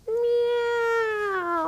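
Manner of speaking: high, drawn-out cat meow. Shown as a demonstration. Teacher meowing like a cat for a child in cat-cow.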